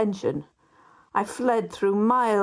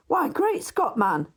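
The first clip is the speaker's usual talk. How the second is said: a much higher startled cry; sudden cry; 7 semitones higher than usual. A narrator's reading. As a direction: burst out, suddenly alarmed. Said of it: "he cried".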